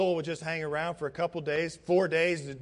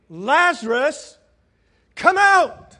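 loud, high, drawn-out summons; commanding shout to someone far off; calling by name; 9 semitones higher, 12 dB louder, much slower than usual. Acted. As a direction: call him out with full force. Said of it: "Jesus called him by name".

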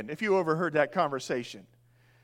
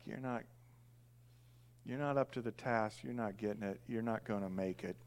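sober Jesus; 8 semitones lower, 9 dB quieter than usual. Retold or imitated. Jesus telling disciples they fail.

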